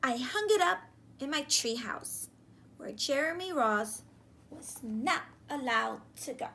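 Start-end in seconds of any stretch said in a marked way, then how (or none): none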